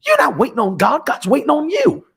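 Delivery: rising preaching climax. Acted.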